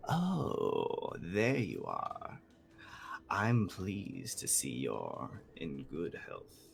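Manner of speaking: slow, drawn-out creature voice, politely probing; stately, leisurely villainous politeness; gargantuan bird, courteous. Acted. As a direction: draw out the greeting like a genteel monster. Game master voices giant bird.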